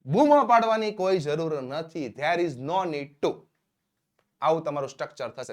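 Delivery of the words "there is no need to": very slow, word by word, for learners; slow dictation. Shown as a demonstration. Teacher to students, slow clear dictation. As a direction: spell it out slowly so students can write. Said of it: "teacher dictates English structure".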